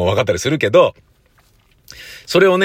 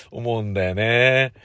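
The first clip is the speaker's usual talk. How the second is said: usual speaking voice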